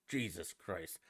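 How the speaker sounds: ordinary talk, nothing marked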